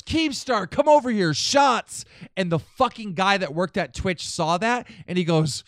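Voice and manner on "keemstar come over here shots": higher and louder, hollering him over; imitating a friend calling him over for shots. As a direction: shout him over for shots like a buddy at a party.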